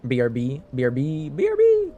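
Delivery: playful rising shout to chat; sing-song yell. Acted. Calling out BRB to chat.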